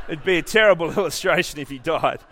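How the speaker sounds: loud, laughing through the words; amused laughing. Real, not acted. Preacher joking with congregation about his illustration, laughing out loud. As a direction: break up at your own joke. Laughs at own false ending.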